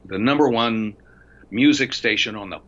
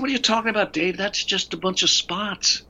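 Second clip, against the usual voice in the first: incredulous; 9 semitones higher than usual. Retold or imitated. Retold disbelief at Dave's demo.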